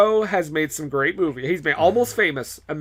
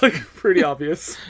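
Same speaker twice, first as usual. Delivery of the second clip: normal talking voice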